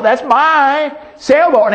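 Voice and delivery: put-on a boy claiming his lost toy, gleefully excited. A narrator's reading. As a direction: insist it's yours, lit up with joy. Boy's rising excitement.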